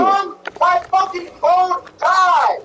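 high, slow defensive shout; drawn-out defensive yell. Real, not acted. Repeats the excuse in the argument.

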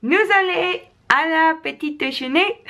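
high, bright mock-French sing-song; showing off tourist French, lifted high; playful French. Speaker greets France in playful high French. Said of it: "that was my French for today".

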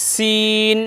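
সিন with a thin s, vowel held long; a level, drawn-out সিন with fine s; thin seen. Shown as a demonstration. Teacher shows how it should sound, long thin সিন. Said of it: "এরকম আওয়াজ হবে".

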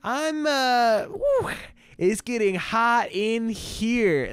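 high, slow hammed-up fluster; mock flustered. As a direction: fan yourself, overheated. Mock flustered by steamy scene.

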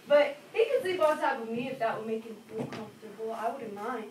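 normal talking voice

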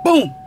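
loud high boom; imitated crash boom. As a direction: shout the crash noise. Sound of the crash.

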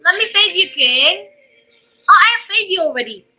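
ordinary talk

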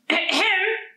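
high, pointed "ahem"; attention-getting throat clear. A narrator's reading. Teacher startling Layla out of her thoughts, pointed throat clear. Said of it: "The teacher made another noise".

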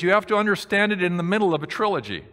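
usual speaking voice

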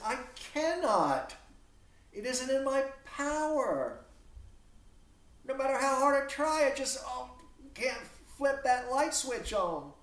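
put-on a frustrated young man insisting he has no power to love; exasperated Demetrius. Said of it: "but he says".

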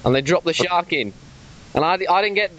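usual speaking voice

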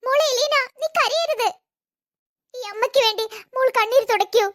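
high, hammed-up soap-opera pleading; milk it like a TV serial heroine; over-acting. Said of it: "over acting".

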